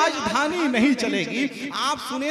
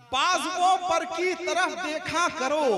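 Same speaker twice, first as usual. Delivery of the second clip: declaimed couplet, raised pitch; poetic recital; 4 semitones higher, 5 dB quieter than usual. Acted. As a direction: recite it like a mushaira poet.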